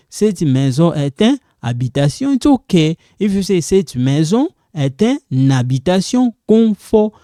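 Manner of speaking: ordinary talk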